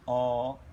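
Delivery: low, held "O"; sustained teaching "O"; vowel demonstration. Shown as a demonstration. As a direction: draw "O" out steadily. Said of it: "Now I will pronounce".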